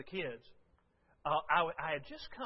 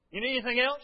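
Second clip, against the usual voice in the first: higher, louder impatient question; impatient, get-lost tone; annoyed brush-off; 8 semitones higher, 10 dB louder, faster than usual. Retold or imitated. Pastor showing Lisa how rude it sounded, impatient. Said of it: "But the way I said it".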